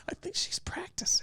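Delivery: hushed mock-secret whisper. Friends joking about someone hidden nearby, whispered.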